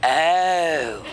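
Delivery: a knowing friend's oh, low and drawn; friend's flat oh. Retold or imitated. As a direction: imitate her loaded oh. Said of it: "Then she goes".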